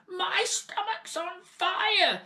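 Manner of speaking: very high, loud, slow panicked shout; sudden shout. A narrator's reading. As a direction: bellow for help like a frantic old woman. Grandmother panicking.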